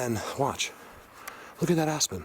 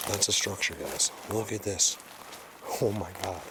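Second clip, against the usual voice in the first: ordinary delivery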